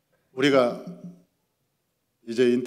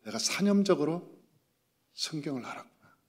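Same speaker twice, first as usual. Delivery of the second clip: normal talking voice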